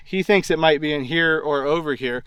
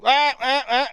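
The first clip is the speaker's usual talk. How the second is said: imitated animal calls; 9 semitones higher, slower than usual. Young animals calling each other.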